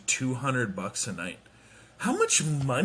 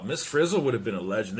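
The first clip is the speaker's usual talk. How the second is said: ordinary talk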